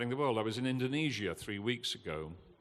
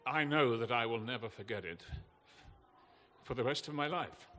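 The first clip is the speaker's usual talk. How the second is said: upset, holding back tears; 3 semitones higher than usual. Real, not acted. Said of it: "I don't want to apologize for being upset".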